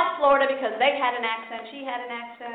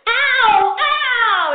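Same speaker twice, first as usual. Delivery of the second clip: loud, high yelps of pain; child yelping; 10 semitones higher, 9 dB louder than usual. Retold or imitated. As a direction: yelp as the brush pulls. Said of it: "I'd go".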